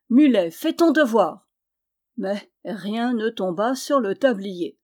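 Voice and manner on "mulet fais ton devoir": loud, imperious command. A narrator's reading. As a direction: give the order like a master. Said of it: "d'un ton impérieux il dit". (ordre lancé d'un ton impérieux)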